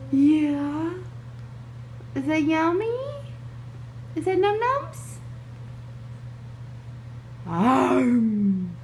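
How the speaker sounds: high cooing sing-song. Real, not acted. Cooing at baby eating.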